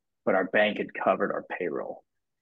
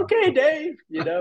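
mock-tearful squeak, much higher; crying; 4 dB louder than usual. Retelling his tearful reply to the stage, much higher and crying. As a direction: replay a choked-up reply through tears. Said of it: "I'm crying".

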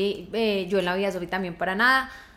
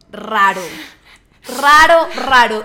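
loud, slow, stunned repeating; hammed-up alarm at strange news; shocked disbelief; 11 dB louder, slower than usual. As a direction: drag out each word in alarm. Her own shocked retold reaction.

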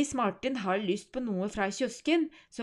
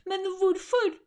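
indignant question; 10 semitones higher, 7 dB louder than usual. Acted. Boy questioning his mother.

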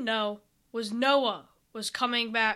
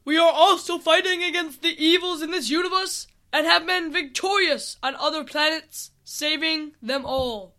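loud, raised heroic proclamation; army leader proclaiming victories; booming voice; 4 semitones higher, 5 dB louder than usual. Acted. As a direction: boom it out to a distant group. Same booming leader answers from afar.

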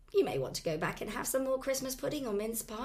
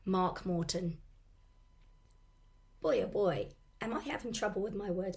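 hoarse voice. Real, not acted. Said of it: "I do have a sore throat and I've lost my voice".

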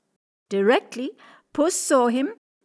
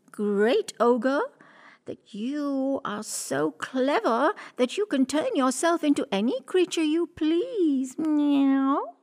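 high, sly flattering cat; 5 semitones higher, slower than usual. Acted. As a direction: coax the ogre, ending in a meow. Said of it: "she said".

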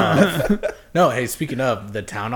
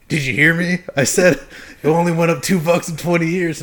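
louder repeat; 7 semitones higher, 8 dB louder than usual. Retold or imitated. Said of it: "he goes".

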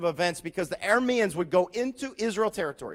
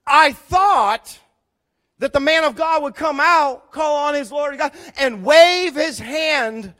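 higher and much louder, indignant shouting; angry; 6 semitones higher, 8 dB louder, slower than usual. Acted. Naaman shouts his complaint about the prophet, loud and angry. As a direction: rant like a proud man snubbed. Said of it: "he left angry".

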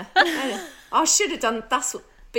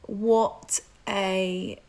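slow deliberate; much slower than usual. Acted. Sets task slowly, deadpan.

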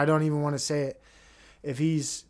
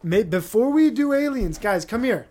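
loud, higher, eager pitch to the boss; hammed-up schemer selling a plan; mock government pitch; 5 semitones higher, 5 dB louder than usual. Schemers pitching their plan to Elon, loud and eager. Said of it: "look at the script and say".

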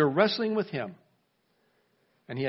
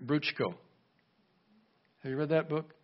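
normal talking voice

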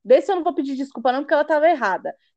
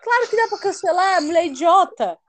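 worked-up, higher-pitched indignant rant; real irritation; 8 semitones higher than usual. Real, not acted. Venting to friends about the rude cashier, indignant. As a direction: fume about the stubborn cashier all over again.

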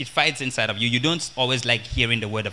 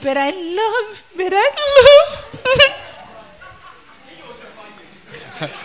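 mock crying; 5 dB louder than usual. Beaten lady refusing to leave her marriage, mock crying. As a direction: imitate a tearful woman wailing out her love. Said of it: "Leave crying".

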